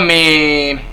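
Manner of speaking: ordinary delivery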